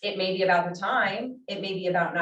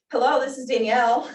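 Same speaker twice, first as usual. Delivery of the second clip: higher, louder mock phone greeting; kid imitating phone voice; 6 semitones higher, 7 dB louder than usual. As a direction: chirp it like a small child. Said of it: "Here's my four-year-old".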